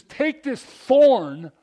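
very loud, high, drawn-out plea; forceful entreaty. Acted. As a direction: cry out slowly, hitting "thorn" hard. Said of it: "The, the word he uses".

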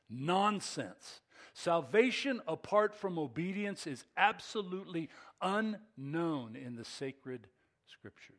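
slow, weighty reading of the quote; quoted with force. Real, not acted. Pastor reads Tozer's verdict with slow, heavy emphasis. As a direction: land each word of the quote hard. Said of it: "Still quoting".

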